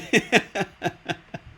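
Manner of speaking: high, loud burst of laughter; real loud laugh. Real, not acted. Laughs at herself.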